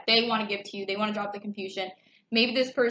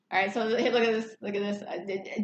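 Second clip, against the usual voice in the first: normal talking voice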